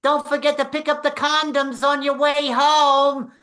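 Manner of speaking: high, loud yell down to the street; yelling out the window. Retold or imitated. Wife yelling from window to husband below, loud and high. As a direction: call it across the block. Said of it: "she'd have to yell out the window".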